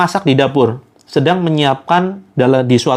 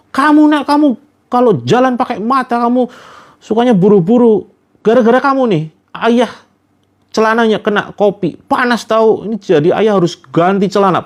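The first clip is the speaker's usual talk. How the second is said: angry father scolding; 5 semitones higher than usual. Retold or imitated. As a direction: scold the child, furious about the spilled coffee. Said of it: "ayahnya marah-marah dong". (ayah memarahi anak karena kopi tumpah, suara membentak)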